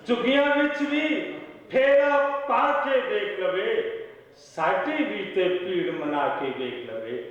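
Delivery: measured mushaira delivery; ghazal recital. Acted. Poet opening a ghazal for the audience, declaimed recital. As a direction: declaim the opening verse, pausing between phrases.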